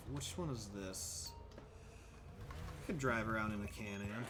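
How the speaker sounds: ordinary talk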